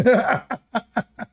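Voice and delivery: a high burst of real laughter. Real, not acted. Mocking Sulaiman's hundred wives, a high laugh. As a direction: laugh out at the hypocrisy.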